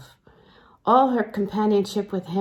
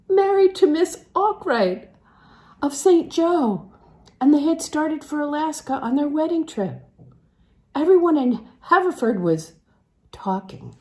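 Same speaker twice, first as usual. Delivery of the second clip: higher and louder, eager to spread the news; gossipy excited announcement; 7 semitones higher, 4 dB louder than usual. Acted. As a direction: burst out with the gossip like a town busybody. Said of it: "Pauline announced the theme at once".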